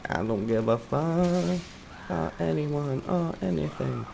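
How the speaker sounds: slow, soft, different voice, like John LaJoy; lightly voiced carefree guy; John LaJoy impression. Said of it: "It's John LaJoy, folks".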